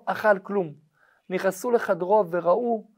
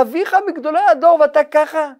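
indignant amazement; 8 semitones higher, 8 dB louder than usual. Acted. Rabbi reacts to the discovery.

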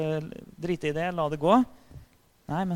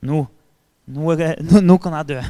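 much louder, bursting with joy; Moses overjoyed; 12 dB louder than usual. Retold or imitated. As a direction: let it burst out, fulfilled. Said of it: "så tenkte sikkert Moses".

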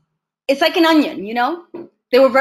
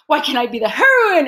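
higher-pitched, exasperated mock wail; pissed-off protest; 5 semitones higher than usual. Student protesting an unwanted role to her teacher, pissed off. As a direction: plead indignantly, drawing out the complaint. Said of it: "I was pissed off".

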